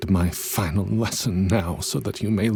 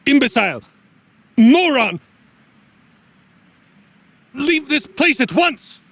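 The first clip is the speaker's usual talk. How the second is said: shrill, loud screaming insults, much higher; furious shout; 7 dB louder than usual. Acted. Mentor screaming insults at his student, furious. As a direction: spit each insult out in rage.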